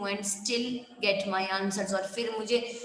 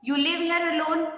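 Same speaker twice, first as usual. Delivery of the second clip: "alone" in a raised, louder question; questioning inflection; 7 semitones higher, 5 dB louder than usual. A narrator's reading. Intruder questions Gerard about living alone, high questioning inflection. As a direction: ask "You live here alone?" high and loud. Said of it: "Question of inflection".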